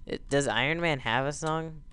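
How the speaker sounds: ordinary delivery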